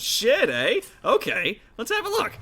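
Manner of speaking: high, loud amused orc voice; a chuckle then get to work; chuckling orc smith. Acted. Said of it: "she kinda chuckles and goes".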